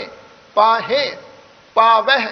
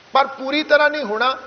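usual speaking voice

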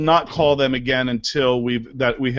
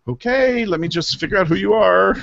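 awkward; 7 semitones higher than usual. Retold or imitated. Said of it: "I'm going".

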